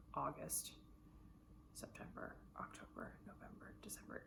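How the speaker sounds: fading to a hushed whisper. Real, not acted. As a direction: breathe the months out, no voice. Listing months in a whisper.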